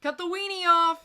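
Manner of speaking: high, loud mocking jab; mocking shout. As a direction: shout it like a joke. Mocking punchline.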